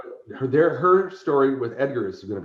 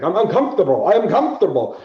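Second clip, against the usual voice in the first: much higher, louder hammed-up Orlok; Count Orlok voice; 7 semitones higher, 8 dB louder than usual. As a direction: ham up the awkward vampire dancer. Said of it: "And he's like".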